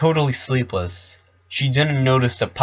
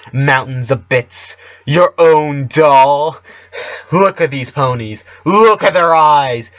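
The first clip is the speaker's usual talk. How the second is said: building from sly gloating to a much louder, higher shriek; gloat, then scream the last lines in ecstasy; frenzied Countess; 6 semitones higher, 8 dB louder than usual. Acted.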